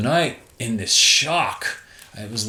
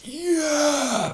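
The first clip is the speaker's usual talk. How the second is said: long high victory yell; triumphant whoop. Retold or imitated. Surfer after the best barrel, high triumphant yell. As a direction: celebrate the ride of your life.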